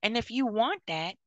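high, loud stern order; stern loud scolding. Acted. Auntie ordering a young mom to put stolen makeup back, loud and stern. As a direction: demand it back right now. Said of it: "and be like".

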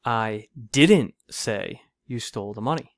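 deliberate, heavy emphasis on "didn't"; stress on DIDN'T. Shown as a demonstration. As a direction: hit the word "didn't" hard, then carry on evenly. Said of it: "with emphasis on each word".

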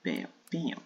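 usual speaking voice

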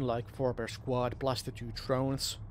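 ordinary talk

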